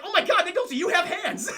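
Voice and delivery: high, loud mock astonishment; hammed-up shocked outburst; amazed exclamation. Everyone's amazed reaction in the movie.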